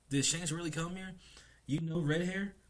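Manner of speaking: high, excited questioning; eager disbelief. Retold or imitated. Luffy asking Loki whether Shanks really came, eager.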